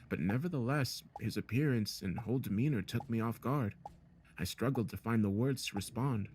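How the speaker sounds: usual speaking voice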